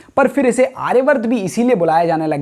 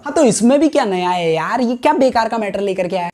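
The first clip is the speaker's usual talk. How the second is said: mock-dismissive interruption from a sceptic; dismissive heckler. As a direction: play an unimpressed viewer butting in. Acts dismissive viewer interrupting. (चिढ़े दर्शक जैसी टोक)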